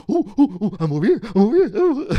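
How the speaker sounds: loud, very high squealing hoots to a dog; brainless-idiot dog talk. Shown as a demonstration. Comic shows how people call dogs like idiots, high squealing baby-talk. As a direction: squeal for a dog's attention at full pitch. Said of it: "treating them like they're some brainless idiot".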